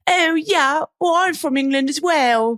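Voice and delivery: very high, slow mock British voice; hammed-up imitation of a fake English accent. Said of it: "everyone puts on a British accent".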